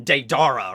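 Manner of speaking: Deidara said day-DAR-uh; Deidara with a stressed "dar"; wrong pronunciation. Shown as a demonstration. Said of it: "he's pronouncing it wrong".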